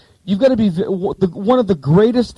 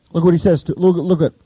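ordinary talk

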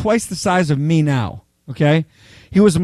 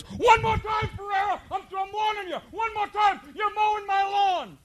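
much higher, strained mock yell at a recruit; screaming drill instructor; 7 dB quieter than usual. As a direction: squeal the threat like a furious sergeant. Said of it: "he comes out and he goes".

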